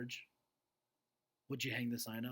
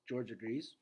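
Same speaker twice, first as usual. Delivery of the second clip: ordinary delivery, nothing marked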